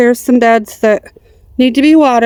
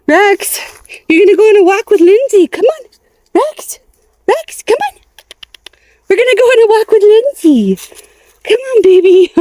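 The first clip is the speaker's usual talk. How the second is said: high, sing-song pet voice, far above her usual; calling the dog; 10 semitones higher than usual. Real, not acted.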